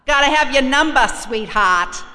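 loud, high Boston tough-guy voice; Boston accent. Retold or imitated. AA volunteer demanding her number, loud Boston accent. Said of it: "That's how they talk up there".

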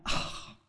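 long voiceless "oh" on the breath; exasperated sigh. Retold or imitated. Woman let down by her sponsor's reply, sighing out. Retold reaction, sighed out.

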